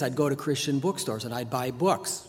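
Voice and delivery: ordinary talk, nothing marked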